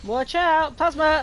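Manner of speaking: high, loud combat call-out; shouted warning. Real, not acted.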